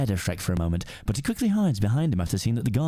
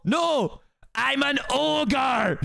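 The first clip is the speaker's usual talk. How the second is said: put-on aggressive Scottish accent; much slower than usual. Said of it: "aggressive Scottish accent".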